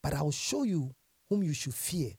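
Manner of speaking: usual speaking voice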